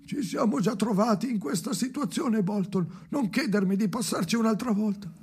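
the other man answering Bolton. Acted. The other voice continues pleading.

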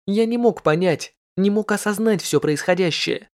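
usual speaking voice

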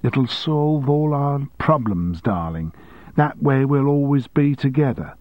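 lightly mimicked, slow crooning lover; mocking his words. Sally mocking her boss's words to Sharon, lightly mimicked. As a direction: parody his sweet talk. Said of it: "I can hear your exact words".

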